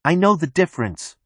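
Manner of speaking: high acted call; shout. A narrator's reading. Marla insists she knows him from Tyler, high restrained shout. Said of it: "Marla shouts".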